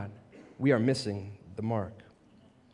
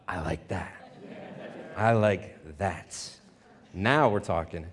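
quiet approval; 7 semitones lower, 5 dB quieter, slower than usual. Retold or imitated. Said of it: "And I thought".

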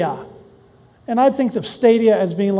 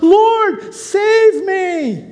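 cry for help; 9 semitones higher, 3 dB louder, much slower than usual. Acted. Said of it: "He cried out".

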